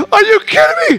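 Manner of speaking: the best news of his life; freed man overjoyed at the priest's death; ecstatic. Acted. Freed man's ecstatic reaction.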